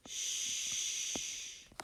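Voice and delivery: Sh-A-P stretched out sound by sound, breathy; segmented sounds, Sh A P. Shown as a demonstration. Teacher stretching shape into sounds for the class. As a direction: tap out Sh-A-P one sound at a time. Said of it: "Our next word is shape".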